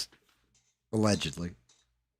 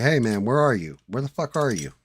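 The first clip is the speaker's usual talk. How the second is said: friend calling out, a bit louder; mock yelling for his buddy; retold yell; 5 semitones higher, 5 dB louder than usual. Friend searching the room, calling out in a mild mock yell. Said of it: "he's yelling for me".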